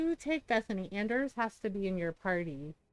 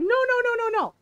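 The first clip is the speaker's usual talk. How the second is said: high, loud string of quick no's; frantic protest; 12 semitones higher, 6 dB louder than usual. Retold or imitated. Husband refusing any nibbling, high frantic no's. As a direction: yelp no over and over, way up. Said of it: "He's like".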